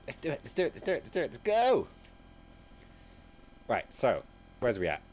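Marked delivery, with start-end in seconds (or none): 0.1-1.9 rally them, voice jumping up; hyped up. Real, not acted. Streamer hyping viewers back into the game, hyped up.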